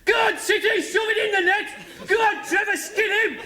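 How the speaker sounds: loud high football-terrace shouting; mate yelling for City in a hostile ground, shouting high and loud; fan shouting. Retold or imitated. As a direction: bellow encouragement at the team. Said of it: "he kept getting up going".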